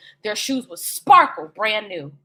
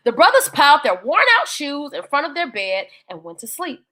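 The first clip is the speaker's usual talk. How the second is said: plain reading voice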